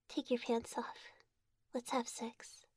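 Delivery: soft, breathy teasing whisper. Acted. Intimate whisper to partner.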